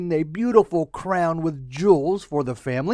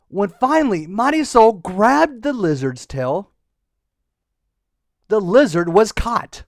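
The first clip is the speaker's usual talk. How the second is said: ordinary delivery, nothing marked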